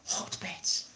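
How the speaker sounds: soft whisper, trailing away; musing whisper. Real, not acted. Speaker mulling over hotbeds, hushed whisper. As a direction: whisper the word slowly to yourself.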